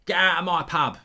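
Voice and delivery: hammed-up loud Peggy Mitchell shout. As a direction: throw him out of the pub, loud. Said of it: "Pat Butcher or Peggy Mitchell?".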